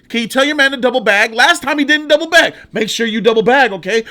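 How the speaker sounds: high, loud, fast bossy yell; hammed-up grocery manager shouting orders. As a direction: bark orders at the bag boy. Voicing the manager ordering Brooks.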